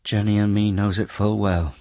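a hint of a weary country wife; brickmaker's wife. Wife agreeing with Bucket, lightly voiced and resigned.

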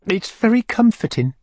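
high, fluttery widow voice; fussy widow voice. A narrator's reading. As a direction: play the flustered widow, pitched high. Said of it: "Widow Corney's voice".

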